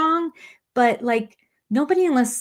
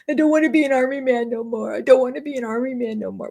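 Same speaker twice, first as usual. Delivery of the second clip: higher-pitched mock plaintive voice; Nick; 6 semitones higher, faster than usual. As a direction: imitate a reluctant recruit's high protest. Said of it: "doesn't wanna be like Nick".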